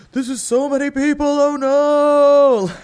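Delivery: high, loud, panicked squeal; mock overwhelmed squeal. Overwhelmed by socializing.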